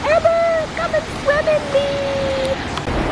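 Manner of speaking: high, slow coaxing call; high beckoning voice. Pretend. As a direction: call her like a siren. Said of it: "water is literally telling me".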